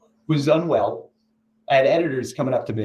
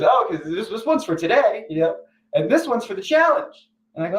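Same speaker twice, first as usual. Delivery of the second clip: higher, chipper boss voice; cheery boss; 8 semitones higher than usual. Retold or imitated. Jimmy announcing the marathon challenge, higher and chipper. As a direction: lightly play an upbeat Jimmy.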